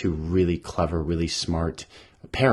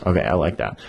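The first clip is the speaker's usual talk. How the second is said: ordinary delivery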